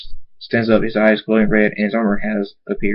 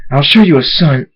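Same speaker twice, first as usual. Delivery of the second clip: loud, raised threatening voice; angry threat; 4 semitones higher, 7 dB louder than usual. Acted. Thunderstorm answering Dave's insult, loud angry threat. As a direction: throw the threat out loud and higher.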